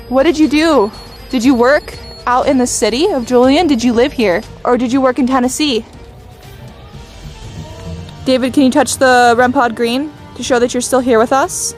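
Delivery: ordinary delivery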